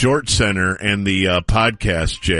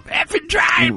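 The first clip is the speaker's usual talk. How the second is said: mock yell; slower than usual. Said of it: "he's just screaming at everybody".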